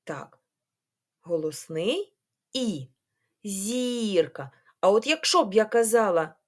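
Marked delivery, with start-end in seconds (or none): 3.4-4.5 ЗІРКА with the І stretched and stressed. Shown as a demonstration. Teacher showing the stressed vowel, long stressed І. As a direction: draw out the first vowel of ЗІРКА, short КА. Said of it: "сильніше".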